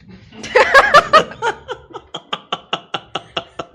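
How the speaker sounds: bursts into a long, loud, high-pitched laugh; real belly laugh, high and loud; laughing out loud at the joke. Real, not acted. Big laugh after thanking Anie.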